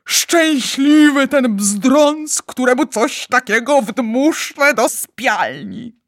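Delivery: high, loud, slow rejoicing; rapturous joy. Acted. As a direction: proclaim it slowly with delight. Giant rejoices over the good dream.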